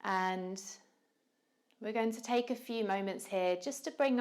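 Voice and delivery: calm, unhurried yoga teacher voice with long pauses; measured meditation cueing, leaving room to breathe; guided meditation. Real, not acted.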